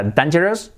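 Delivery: dangerous wrongly as DAN-jeros; pronunciación incorrecta. Shown as a demonstration. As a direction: say "dangerous" with flat Spanish a. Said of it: "Que no sea". (dangerous mal dicho, 'dan-yeros')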